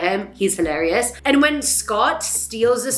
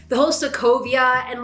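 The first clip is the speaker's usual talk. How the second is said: ordinary talk, nothing marked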